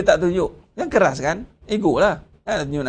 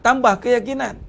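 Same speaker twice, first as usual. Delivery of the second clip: usual speaking voice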